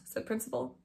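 ordinary delivery, nothing marked

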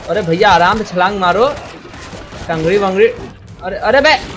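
ordinary delivery

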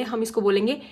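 ordinary talk, nothing marked

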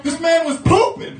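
high loud mocking announcement. Classmate telling the class he was pooping, high loud mockery. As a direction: broadcast it to the whole class.

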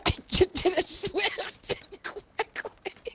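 giggling through the words; squeaky laughing voice. Real, not acted. Laughing mid-line.

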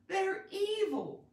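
high, drawn-out dramatic protest; overblown, high-pitched outcry; over-the-top dramatic. Shown as a demonstration. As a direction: play it big and melodramatic. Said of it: "since this is so dramatic, over the top".

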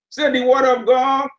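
usual speaking voice

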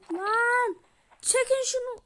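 ordinary talk, nothing marked

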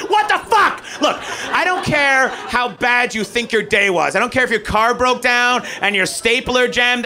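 normal talking voice

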